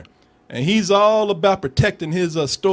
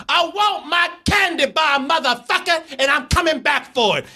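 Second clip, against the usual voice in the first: taunting shout; 8 semitones higher than usual. Retold or imitated. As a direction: jeer at the shopkeeper from the street. Girl taunting the shop owner.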